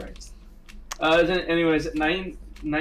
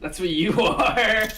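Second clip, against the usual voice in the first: laughing; 6 semitones higher, 4 dB louder, slower than usual. Real, not acted. Laughing retort.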